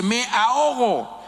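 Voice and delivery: me ahogo drawn out, high and urgent, like real panic; desperate cry. Shown as a demonstration. Preacher showing the right way to call for help, drawn-out desperate cry. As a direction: cry me ahogo slowly as if really drowning. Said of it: "se dice".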